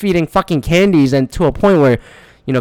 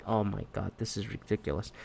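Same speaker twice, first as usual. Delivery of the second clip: very low, hushed aside; dropped-voice exasperation; muttered disbelief; 8 semitones lower, 20 dB quieter than usual. Retold or imitated. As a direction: mutter it under your breath. Retold muttered reaction to heckling kid.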